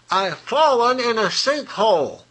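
a hint of a trapped horse calling for help. Horse in the sinkhole calling up to the chicken, lightly voiced.

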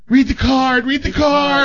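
high, flirty singsong punchline; coy nurse. Acted. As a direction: tease him over the shoulder. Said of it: "the nurse goes over his shoulder".

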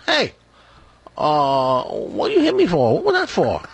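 high, indignant mock-Chinese character voice; mock Chinese accent. Chinese guy to the man who punched him, indignant mock Chinese accent. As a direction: play a joke's Chinese guy protesting, high-pitched. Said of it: "the Chinese guy picks himself up".

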